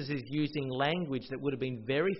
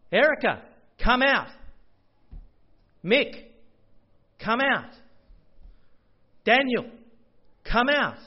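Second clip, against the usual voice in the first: loud, higher summons, each name called; loud calling out; 9 semitones higher, 8 dB louder, slower than usual. Acted. Preacher voicing Jesus calling congregants by name, loud summons. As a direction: call each person from the grave.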